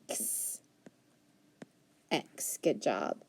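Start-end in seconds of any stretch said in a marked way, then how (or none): 0.1-0.6 "X" as a voiceless, softer "kss"; letter sound X. Shown as a demonstration. Teacher repeating a sound for pupils, isolated letter sound. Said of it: "X sound".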